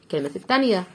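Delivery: normal talking voice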